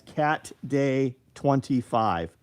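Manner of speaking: each word said slowly and clearly; slow, deliberate read of Cat Day twenty-five; slow code read-out. Shown as a demonstration. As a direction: spell out the code slowly for viewers. Spelling out the discount code.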